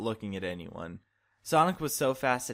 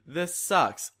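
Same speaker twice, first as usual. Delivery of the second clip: higher, louder outburst of frustration; frustrated yell; 6 semitones higher, 4 dB louder than usual. Acted. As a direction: vent it at the locker. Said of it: "where he screamed".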